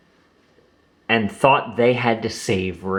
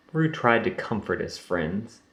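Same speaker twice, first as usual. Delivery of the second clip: plain reading voice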